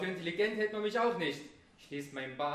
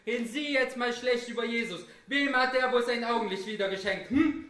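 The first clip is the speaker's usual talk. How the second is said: higher and louder with each question, ending in shouted nos; rising challenge; 7 semitones higher, 9 dB louder than usual. Acted. Daring the audience about Jesus, building to shouts. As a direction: build to a shouting quiz at the crowd.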